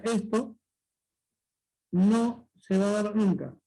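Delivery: "no se va a dar nunca" said slowly and firmly; slow emphatic rule. Shown as a demonstration. Teacher stressing the rule slowly so students remember. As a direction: lay it down slowly so they remember. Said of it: "Lo voy a ampliar para que lo recuerdes".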